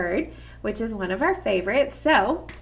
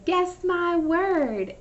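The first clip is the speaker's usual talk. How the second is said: high, slow guess my word; game call; 8 semitones higher, 4 dB louder, much slower than usual. Shown as a demonstration. Teacher calls out the game phrase to kids, high and slow. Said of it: "I'm gonna say".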